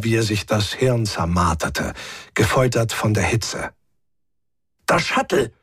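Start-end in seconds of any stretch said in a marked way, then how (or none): 4.8-5.5 high exclamation of a sudden idea; excited, higher-pitched cry; sudden call. A narrator's reading. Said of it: "rief er plötzlich".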